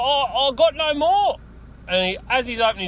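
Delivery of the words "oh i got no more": higher, slow, feigned-innocent whine; mock crackhead. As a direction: play the buyer pretending to be broke. Imitating the crackhead again.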